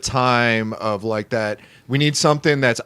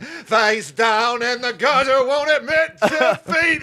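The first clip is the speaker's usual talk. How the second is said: mock Irish punk; 9 semitones higher, slower than usual.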